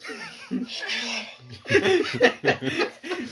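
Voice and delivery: high laugh. Real, not acted. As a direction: cackle high and hard.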